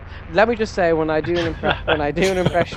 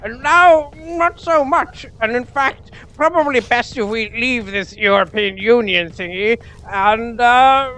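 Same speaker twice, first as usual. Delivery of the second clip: put-on swooping, halting English toff accent; controversial English accent; 3 semitones higher than usual. Mocking England over its lost empire, pompous English accent. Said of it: "wasn't the English accent I was gonna do".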